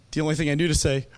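ordinary talk

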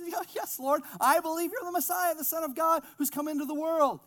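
high, loud acted agreement; hurried insistent assent. Martha answering Jesus's question, high insistent assent. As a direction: pitch it way up, agreeing emphatically.

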